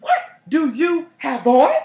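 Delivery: loud, high, drawn-out question; appalled demand. Retold or imitated. Asking the date about his cologne, loudly appalled. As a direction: stretch each word in loud disbelief.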